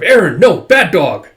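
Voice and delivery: loud, sharp dog command; mock scolding. Owner to a dog jumping up, mock scolding.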